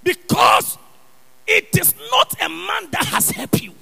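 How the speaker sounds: loud, high preacher's cry; preaching shout. Acted. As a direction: shout it to the back pews.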